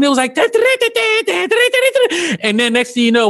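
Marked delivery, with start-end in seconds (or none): 0.3-2.4 high-pitched frantic toon music mimicry; sing-song "da da" squealed very high; imitated cartoon noise. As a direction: mimic a whirling cartoon soundtrack by mouth. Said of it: "was just a fucking cartoon".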